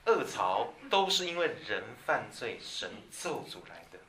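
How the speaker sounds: normal talking voice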